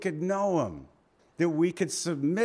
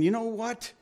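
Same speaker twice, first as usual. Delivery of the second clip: man in line; 8 semitones higher than usual. Retold or imitated. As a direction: play the guy behind him calling out. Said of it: "he's saying".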